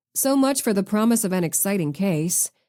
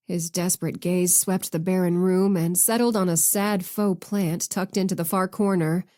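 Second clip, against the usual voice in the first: plain reading voice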